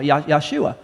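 Yahshua repeated as yah-SHOO-ah; "Yahshua" with the stress on SHOO; Hebrew pronunciation. Shown as a demonstration. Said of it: "That's how they say it".

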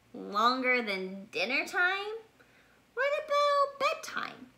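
rising sharply high at bedtime; a little bear amazed at the wait; astonished cub. Acted. Bear cub asks mommy in disbelief about bedtime.

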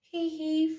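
ordinary delivery

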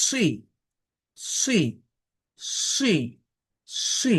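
সি with lips lightly rounded, thick and hissed; emphatic sad with kasra. Shown as a demonstration. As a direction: round the mouth a little on সি. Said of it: "মুখটা হালকা গোল হবে".

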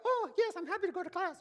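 put-on very high, eager little-girl voice; Andrea. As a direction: squeal it like a delighted, obedient child. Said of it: "You know Andrea".

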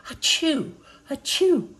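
two high pretend sneezes; sneezing. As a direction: sneeze it out twice. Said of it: "They both sneezed".

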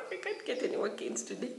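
high-pitched, through a laugh; laughing protest. Retold or imitated.

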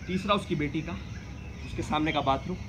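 ordinary delivery

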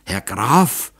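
halting, faltering address; words catch in a scared throat; stammering. A narrator's reading. The hunter addresses his shaken master, a mild stammer. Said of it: "stotterte Batscheida".

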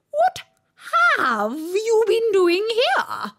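very high, loud, slow demand; furious queen. A narrator's reading. Queen interrogating the gardeners, high and furious. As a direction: interrogate them, outraged.

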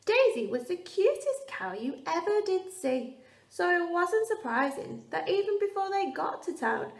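ordinary delivery, nothing marked

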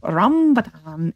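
slow RAMBATAN, first syllable stretched and punched; specific playful way. Shown as a demonstration. Streamer saying a word the specific way, playful relish. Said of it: "you gotta say it a specific way".

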